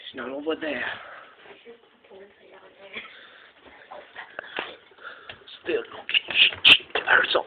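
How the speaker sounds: nervous, very soft and quick; scared hushed talk. Pretend. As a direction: mutter it afraid of being heard. Hiding while hunting the dog.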